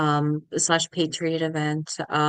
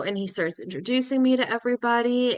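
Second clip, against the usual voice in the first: normal talking voice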